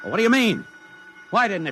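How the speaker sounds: high, loud, urgent questioning; alarmed demand. Acted. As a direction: demand an answer now. General demands why bomb failed.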